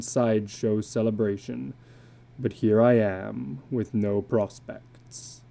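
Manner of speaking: a worn-out carnival man, resigned to bad luck; illustrated man. Illustrated man bemoans his luck.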